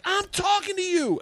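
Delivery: very high, drawn-out mock yell; imitated yelling. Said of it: "she was yelling at me".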